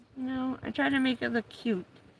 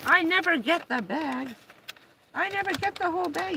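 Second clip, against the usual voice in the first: higher, quick gleeful dog voice; voicing the dog; 7 semitones higher than usual. Owner voicing dog with bag, higher and quick. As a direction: brag as the dog.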